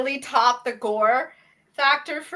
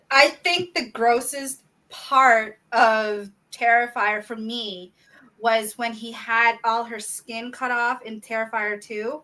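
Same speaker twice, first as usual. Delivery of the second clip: ordinary talk, nothing marked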